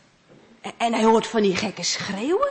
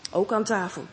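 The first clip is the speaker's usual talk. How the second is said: usual speaking voice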